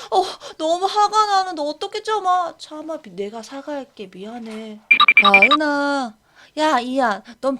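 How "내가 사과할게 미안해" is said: ordinary delivery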